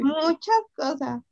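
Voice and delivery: speaking through a yawn; words stretched by a yawn. Real, not acted. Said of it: "otra vez estoy bostezando".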